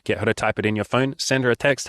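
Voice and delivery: usual speaking voice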